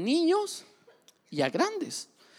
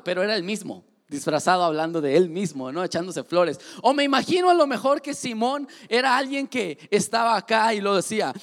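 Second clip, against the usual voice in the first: ordinary talk, nothing marked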